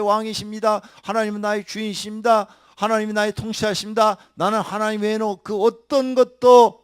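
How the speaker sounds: loud, high sustained preaching; preacher voicing a believer's vow; fiery preaching. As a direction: declare faith at the top of the voice. Preaching at full force.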